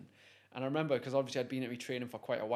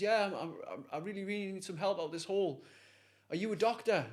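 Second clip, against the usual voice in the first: pleading; 6 semitones higher than usual. Retold or imitated. Trapped man pleading with doctor.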